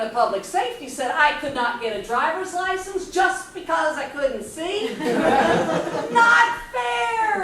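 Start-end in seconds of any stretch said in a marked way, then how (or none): 5.0-7.4 loud, high, drawn-out yell; screamed protest. Retold or imitated. Teenager refused a driver's license, screaming in protest. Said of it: "I screamed again".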